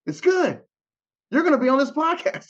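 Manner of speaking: high-pitched imitated enthusiasm; enthusiastic approval. Acting out musician's enthusiastic reply.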